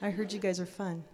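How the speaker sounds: soft, low mock-audience voice; imagined audience thought. Storyteller voicing the audience's doubt, soft and low. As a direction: murmur it as a doubting listener. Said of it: "some of you might be thinking".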